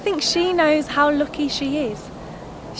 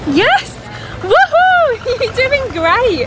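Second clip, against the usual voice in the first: high, loud whoop of encouragement; whooping, drawn-out high shout; excited cheering; 9 semitones higher, 5 dB louder, much slower than usual. Acted. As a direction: cheer the rider on at the top of your voice. Cheering on a bike rider.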